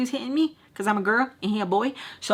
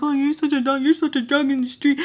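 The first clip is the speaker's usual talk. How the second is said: higher-pitched imitation of a scolding mom; mimicked motherly put-down, pitched up; mom's saying; 6 semitones higher, 4 dB louder than usual. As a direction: quote his mom telling him off. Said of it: "his mom has that saying".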